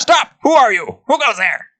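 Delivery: high, loud goblin shout; high-pitched sentry yell; goblin guard challenge. Acted. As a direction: challenge intruders in a shrill goblin voice.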